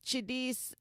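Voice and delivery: "Jadis" said the American way; American pronunciation. Real, not acted. Podcast host naming her co-guest, American pronunciation of "Jadis". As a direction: pronounce "Jadis" with an American reading. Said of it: "I'm pronouncing your name the American way".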